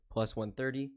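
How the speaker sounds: ordinary delivery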